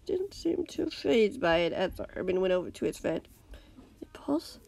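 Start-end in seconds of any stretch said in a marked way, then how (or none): 4.0-4.6 faint, dry whisper; barely voiced, weak from screaming; hoarse whisper of a captive. A narrator's reading. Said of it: "his voice no more than a whisper".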